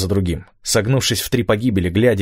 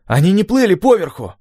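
high, louder excited cry; astonished; 12 semitones higher, 5 dB louder than usual. A narrator's reading. Gabby realises how they arrived, excited and astonished. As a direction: exclaim the discovery at high pitch.

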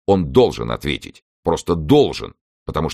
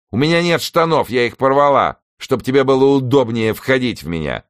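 insistent; 10 semitones higher, 4 dB louder than usual. Acted. Helen argues with Newson in bedroom.

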